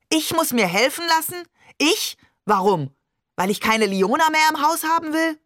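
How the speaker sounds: high-pitched indignant outburst; exploding. A narrator's reading. Father told he needs help, exploding. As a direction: fire back questions in outraged disbelief. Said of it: "Barbe explodierte".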